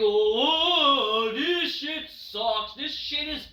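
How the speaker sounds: slow, dragged-out mock groan voice; slow drawn-out mock disgust; mocking own reaction. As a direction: drawl it out, disgusted. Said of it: "Oh, I go".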